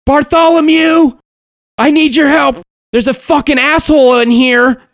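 high shout calling for help; yelling for help. Acted. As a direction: scream across the room for Bartholomew. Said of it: "she yells out".

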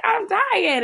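high, mock-wailing protest; Carrie. Carrie answering the gym teacher's slap, high mock wail. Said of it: "And Carrie's like".

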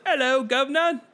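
high, loud, hammed-up British accent; worst British accent. Podcaster mocks the actor's attempt, terrible British accent. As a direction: do a terrible, over-the-top British greeting. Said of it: "like, the worst British accent".

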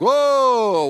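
playfully taken aback; mock offence. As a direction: draw it out in mock shock. Mock offence at being compared.

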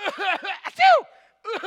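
loud, high mock sneeze between coughs; hack, then sneeze explosively; hammed-up sick fit; fake sneezing and coughing. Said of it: "now starts sneezing too".

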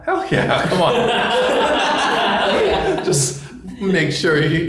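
high, laughing brag. Real, not acted. Laughing admission.

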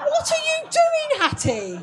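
very high shocked question. Retold or imitated. Senior carer walking in on Hattie, shocked. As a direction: catch her in the act, aghast.

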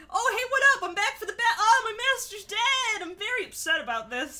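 high, loud mocking Obi-Wan voice; Obi-Wan impression. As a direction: play a clueless Obi-Wan. Mocking Obi-Wan's reaction.

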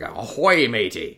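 pirate voice. Retold or imitated. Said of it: "speaking in crappy pirate lingo".

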